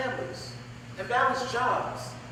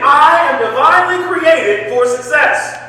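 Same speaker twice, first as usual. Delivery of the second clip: loud, meant affirmation; 2 semitones higher, 13 dB louder, slower than usual. Shown as a demonstration. Speaker leading students in a fervent affirmation, loud and slow. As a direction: declare it louder and slower, with full conviction. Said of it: "say it like you mean it".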